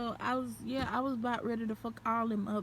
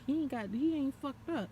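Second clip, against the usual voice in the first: ordinary delivery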